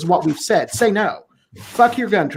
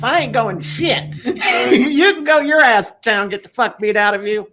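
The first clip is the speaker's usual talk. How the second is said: high mock defiant voice, laughing near the end; defiant Tonto; 6 semitones higher than usual. Tonto refusing the Lone Ranger, high defiant mock voice. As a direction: play Tonto telling the boss off. Voicing Tonto talking back.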